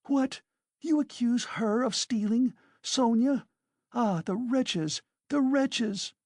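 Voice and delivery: indignant outburst. A narrator's reading. Katerina rushes at Luzhin.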